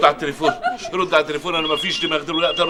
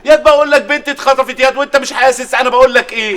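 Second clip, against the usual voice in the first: higher, louder exasperation; exasperated yell; 7 semitones higher, 4 dB louder than usual. Acted. Father yelling at friend who doesn't grasp kidnapping, furious. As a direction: shout it at a friend who won't get it.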